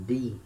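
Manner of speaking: "D" said as a plain "dee"; hard D sound. Shown as a demonstration. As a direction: tap a clean d in "D", no j sound. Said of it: "in some regions".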